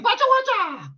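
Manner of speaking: high, sharp fighting shout; kung fu yell. Acted. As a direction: yell like a martial artist. Ear-fighting move, martial yell.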